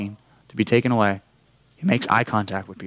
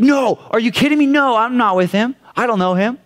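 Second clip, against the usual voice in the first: higher, louder frantic denial; Peter's panicked denial; 10 semitones higher, 4 dB louder than usual. Retold or imitated. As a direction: deny it in a rush of panic. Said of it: "Peter's like".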